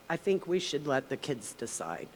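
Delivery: usual speaking voice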